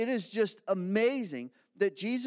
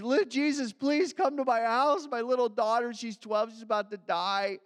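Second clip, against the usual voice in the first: higher and louder, pleading for his daughter; begging father; 5 semitones higher, 5 dB louder than usual. Acted. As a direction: beg desperately on your knees. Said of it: "he's literally begging him".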